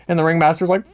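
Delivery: usual speaking voice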